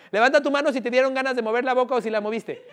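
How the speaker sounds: ordinary talk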